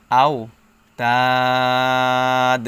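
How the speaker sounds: very long "তাদ", closed on a vowelless d; loud, sustained vowel in "তাদ" ending on a bare d; stop with sakin. Shown as a demonstration. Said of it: "সাকিন দিয়ে পড়তে হবে". ("তাদ"-এ লম্বা টান, শেষে দাল সাকিন)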